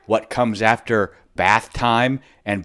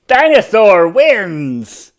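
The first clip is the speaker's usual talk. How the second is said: slow, loud triumphant cheer; 8 semitones higher, 8 dB louder, slower than usual. A narrator's reading. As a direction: announce the victory proudly. Triumphant announcement.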